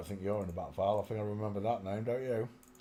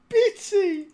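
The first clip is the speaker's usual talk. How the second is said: much higher and louder, falling; incredulous cry; 13 dB louder than usual. Real, not acted. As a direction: squeal the name in disbelief. Repeats the name incredulously.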